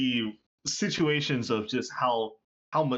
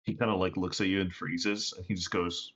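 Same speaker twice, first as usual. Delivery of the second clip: usual speaking voice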